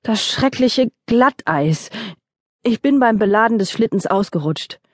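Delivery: moaning. Acted. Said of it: "jammerte er".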